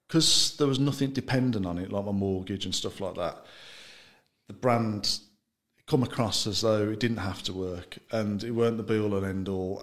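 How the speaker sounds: usual speaking voice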